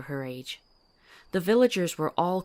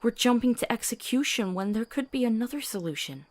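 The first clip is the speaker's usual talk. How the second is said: heated protest; 6 semitones higher than usual. Acted. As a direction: fight back against the cold plan. Protesting the plan to kill.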